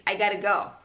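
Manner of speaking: 'I gotta go' as one linked chunk; casual run-together 'I gotta go'; quick linking. Shown as a demonstration. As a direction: blend 'got to' into 'gotta' in 'I gotta go'. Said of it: "diucapkannya dengan cepat".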